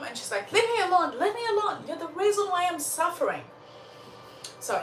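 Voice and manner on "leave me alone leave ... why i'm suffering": high-pitched outburst, hurrying at the end; acted distraught snapping, without sobs; upset crying mother. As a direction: lash out at the child, voice shooting up. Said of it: "she's crying and crying".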